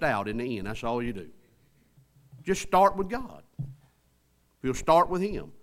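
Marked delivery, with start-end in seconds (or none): 2.4-5.5 high-pitched, urgent exhortation; forceful preaching. Real, not acted. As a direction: press each plea home to the congregation.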